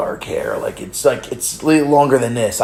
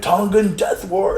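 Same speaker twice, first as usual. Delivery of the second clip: mock announcer; 9 semitones higher, slower than usual. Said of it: "would come up and".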